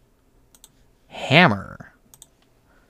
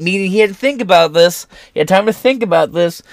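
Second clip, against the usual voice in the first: normal talking voice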